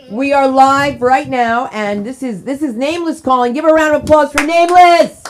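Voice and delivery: loud hype announcer. Real, not acted. Hyping a caller on air.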